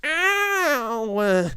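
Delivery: put-on high, loud boy's yelp; stung as if by a hat pin; yelp of pain. A narrator's reading. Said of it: "he yelled".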